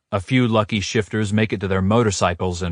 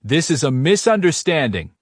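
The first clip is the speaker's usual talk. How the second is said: commanding shout; 8 semitones higher than usual. A narrator's reading. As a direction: take control of the crowd. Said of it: "alpha dominance in his voice".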